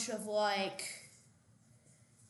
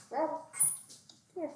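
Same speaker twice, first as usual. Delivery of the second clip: calling a dog; 5 semitones higher, slower than usual. Real, not acted.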